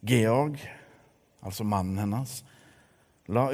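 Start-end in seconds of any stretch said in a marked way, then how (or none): none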